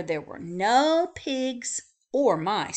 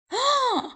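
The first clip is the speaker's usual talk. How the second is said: sharp gasp; 6 dB louder than usual. A narrator's reading. Sudden cold air, gasp.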